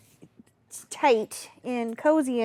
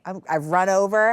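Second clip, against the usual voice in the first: usual speaking voice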